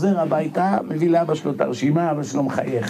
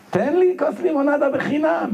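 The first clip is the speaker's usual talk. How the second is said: innocently pleading for a free lemonade, pitched higher; naive plea; 10 semitones higher than usual. Retold or imitated. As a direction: ask like a guileless yeshiva student. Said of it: "בתמימותו".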